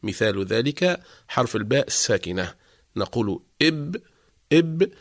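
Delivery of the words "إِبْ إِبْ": "إب" cut off on a vowelless b; consonant with sukoon. Shown as a demonstration. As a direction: stop the sound of "إب" at the lips. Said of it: "بتسكينه مع إدخال همزة قبله". (نطق "إب" بباء ساكنة ينقطع عندها الصوت)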